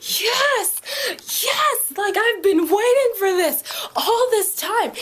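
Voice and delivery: shrieking high, loud yes; ecstatic yell. Retold or imitated. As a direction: scream for joy at the screen. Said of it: "and I was like".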